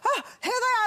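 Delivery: a high, airy gasp of relief. Retold or imitated. Parent spotting a lost child, hammed-up relief. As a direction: sound like you just found them.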